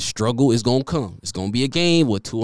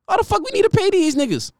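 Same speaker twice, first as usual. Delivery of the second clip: much higher, dismissive; mock team executives; 10 semitones higher than usual. NFL owners dismissing running back pay, high scoffing. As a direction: scoff like a cheap front office. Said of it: "They was like".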